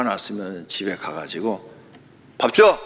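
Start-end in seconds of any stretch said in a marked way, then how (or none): 2.4-2.8 short loud demand, pitched up; acted call for dinner; demanding husband. Angry husband going home to his wife, acted loud demand.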